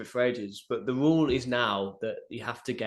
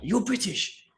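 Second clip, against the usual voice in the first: high-pitched, urgent exclamation in imitation; Gaston accosting him; 11 semitones higher, slower than usual. Official runs up to British coach, high urgent mock accusation. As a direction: imitate someone running up to accost you. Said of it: "Gaston ran up to me and he's like".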